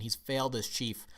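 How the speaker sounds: normal talking voice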